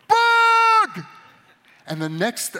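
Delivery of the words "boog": much higher, much louder shout of the name; panicked yell. Retold or imitated. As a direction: scream the name in alarm. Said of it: "I'm sorry, I yelled".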